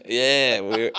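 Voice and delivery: usual speaking voice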